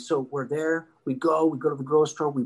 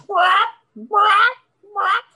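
high, loud percussive bursts; imitated whaps; 7 dB louder, much slower than usual. Man snatching bales in a hurry, high loud whaps. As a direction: punch out each grab as a sound effect.

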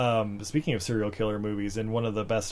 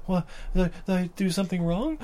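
high, quick bewildered stammer; naive, worried little question; wide-eyed innocent; 8 semitones higher, faster than usual. Acted. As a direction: play a confused innocent, pitched up and hurried. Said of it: "like, the wide-eyed".

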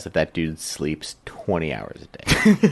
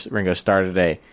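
usual speaking voice

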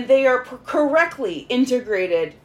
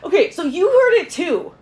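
excited; 9 semitones higher, 6 dB louder than usual. Real, not acted. Excited at same words.

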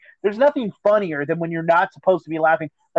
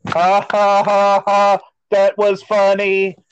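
slow, droning mock laughter; put-on laugh, then slow hollow praise; fake-amused audience; 4 dB louder, slower than usual. Imitating people who pretend it was funny, forced amusement. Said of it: "And people go".

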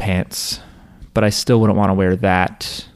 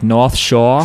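"North Shore" slowly with the r's dropped, as "Nawth Shaw"; Boston accent demo. Shown as a demonstration. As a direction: show the Boston vowels, slow and drawn out. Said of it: "people miss out on vowels like aw".

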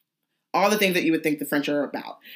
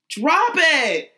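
mocking Hagrid; 11 semitones higher, slower than usual. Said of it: "it's like".

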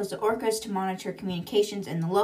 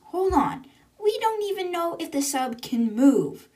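much higher, louder, cutting in urgently; alarmed objection; 9 semitones higher, 4 dB louder than usual. Retold or imitated. Jim cutting in on the plan, alarmed and raised. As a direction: stop them before they rush off.

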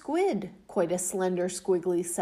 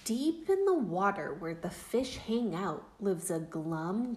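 usual speaking voice

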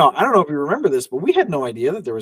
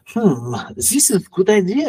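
put-on French voice, pitched up; French accent; 7 semitones higher than usual. Filmmakers weighing up an actress's costume idea, French accent. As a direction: imitate a French filmmaker, as if smoking. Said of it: "every time I do this French voice".

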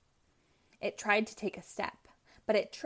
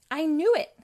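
excited exclaim; 7 semitones higher, 9 dB louder than usual. Acted. Sonic's sudden realization.

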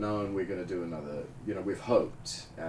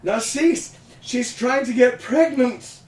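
Catherine voice; 12 semitones higher, 10 dB louder, slower than usual. Retold or imitated. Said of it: "Catherine, goes".

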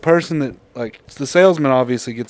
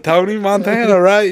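salesman; 6 semitones higher, 3 dB louder than usual. Retold or imitated. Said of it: "he said".

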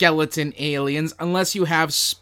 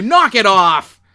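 loud scold; 5 semitones higher, 8 dB louder than usual. Acted. Scolding cohost playfully.

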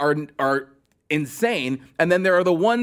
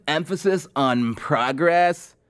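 slow mocking echo of a candidate; imitating a candidate's slow slogan; aging surfer bro; slower than usual. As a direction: draw out "progress." like a surfer-bro politician. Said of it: "that aging surfer bro from Montana".

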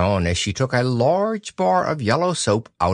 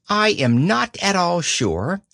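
slow, higher musing; thoughtful; 8 semitones higher than usual. A narrator's reading. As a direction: ponder it slowly aloud. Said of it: "said Kanga in a thoughtful voice".

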